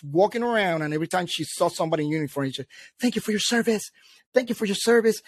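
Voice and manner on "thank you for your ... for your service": lightly mimicked, higher-pitched thanking; imitated eager thanks. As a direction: chirp it like a habitual greeting, mildly mocking. Mimics her thanking everyone in uniform.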